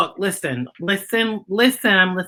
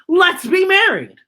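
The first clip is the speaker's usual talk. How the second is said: eager worm; 11 semitones higher, 4 dB louder, slower than usual. A narrator's reading. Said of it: "answers worm".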